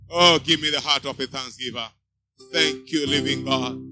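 ordinary talk

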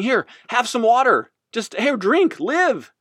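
high-pitched, pressing offer of help; urgent rescuer. Acted. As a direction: act out rushing to save someone. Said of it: "rushing over to this guy and saying".

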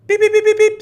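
piercing, very loud high pips; whistle blasts. Retold or imitated. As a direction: imitate a sharp stop whistle to the dog. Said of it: "Stops to the whistle".

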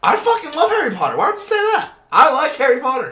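high, loud offended protest; indignant. Real, not acted. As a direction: defend it, outraged. Offended defense of Harry Potter.

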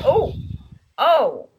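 «Oh, oh» высоко и растерянно, затем «okay»; отец растерянно принимает новость о беременности; stunned surprise. Retold or imitated. Ridge hears pregnancy news.

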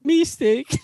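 mock-serious high echo. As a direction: jeer the word like a punchline. Mocking the brand's apology.

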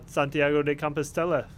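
usual speaking voice

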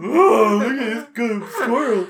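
high, doting pet-talk voice; cooing at the animal. Retold or imitated. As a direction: coo at the critter like it's a pet. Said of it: "sticking my head up going".

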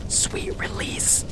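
put-on a despairing girl whispers to herself. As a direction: breathe it out, resigned, slow. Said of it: "She whispers to herself".

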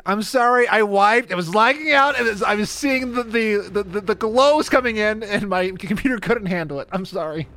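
mock raid apology. Imagined raid apology after wiping.

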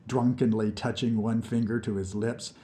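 plain reading voice

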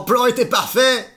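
high, loud hammed-up wail; mock despair. Flantier wails as his pigeon is shot, high and loud. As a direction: wail over the ruined plan.

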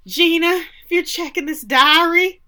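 diary voice. Acted. Reading Lenny's diary entry.

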